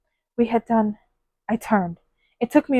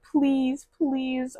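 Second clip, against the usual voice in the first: 1 imitated pleading; 4 semitones higher, 5 dB quieter than usual. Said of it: "Literally, like".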